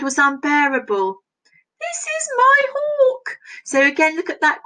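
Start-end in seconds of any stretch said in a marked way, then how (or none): 1.8-3.4 much higher, slow, shocked voice; dismayed exclamation. Acted. Falconer seeing her new hawk, high dismayed cry. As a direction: cry it out, slowly and high, in disbelief. Reading a dismayed quote aloud.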